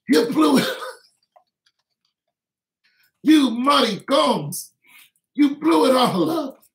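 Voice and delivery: high, loud, with a cracking cry at the end; hammed-up rage rising to a crying shout; mock furious yell. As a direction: scream at them, voice breaking into a shriek. Yelling at league bosses.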